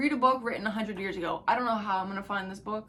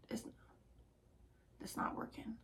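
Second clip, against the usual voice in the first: whisper; 18 dB quieter than usual. Real, not acted. Repeating to herself, whispered.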